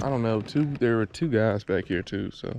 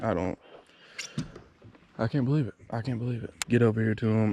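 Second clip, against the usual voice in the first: ordinary talk, nothing marked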